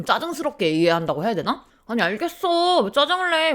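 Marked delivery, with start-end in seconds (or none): 1.9-3.5 much higher, irritated comeback; snappy retort. Retold or imitated. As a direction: fire back at the nagging husband. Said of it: "저도 약간 말투가 좋게 안 나갔어요".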